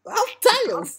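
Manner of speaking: put-on very high squeaky kid voice; Tails voice. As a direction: pipe it up like a cartoon kid. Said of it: "That one part where he said".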